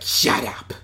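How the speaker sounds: low, rasped creature voice; Bosk telling him off. Retold or imitated. Bounty hunter telling another to shut up, low rough voice. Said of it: "Bosk is like".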